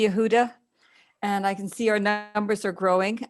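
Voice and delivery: normal talking voice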